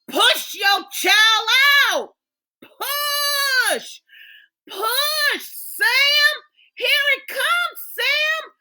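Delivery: high, loud urging; urgent encouragement. Acted. Urging the push, calling out.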